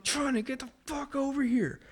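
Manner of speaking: high frantic yell at the dog; panicked dad calling the dog; exasperated shout at dog. Retold or imitated. Yelling at dog covered in mess.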